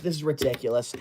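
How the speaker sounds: usual speaking voice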